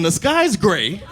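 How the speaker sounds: very slow, deliberate words; slow explaining to a kid. Retold or imitated. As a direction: spell it out slowly for a child. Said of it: "I go to his level".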